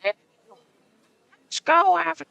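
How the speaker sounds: put-on high, slow old lady voice. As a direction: croak it like an old woman. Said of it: "do the fucking old lady voice".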